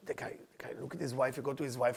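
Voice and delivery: 1 hushed telling. A narrator's reading. Husband turns to wife, hushed.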